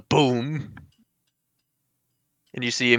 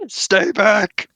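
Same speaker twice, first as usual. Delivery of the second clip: higher, loud warning shout; 9 semitones higher, 6 dB louder than usual. Acted. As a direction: yell to keep them away. Reading villain line aloud.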